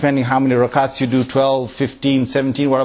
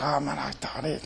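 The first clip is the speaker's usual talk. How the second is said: bored grumble; 13 dB quieter than usual. Acted. Acted bored listener's grumble.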